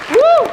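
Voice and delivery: loud high whoop; cheering whoop. Real, not acted. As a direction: whoop for the winners. Cheering the winning team.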